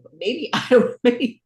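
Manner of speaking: breaks into a laugh mid-phrase; a short laugh, then carry on. Real, not acted. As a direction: laugh at your own doubt. Unsure about the accent.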